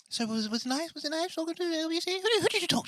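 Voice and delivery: very high, nagging put-on voice; high-pitched comic character, pressing for details; eager high-voiced sidekick. Puppet-like alter ego interviewing him.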